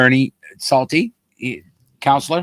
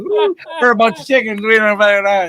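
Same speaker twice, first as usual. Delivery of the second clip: mock partier; 6 semitones higher than usual. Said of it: "They're just".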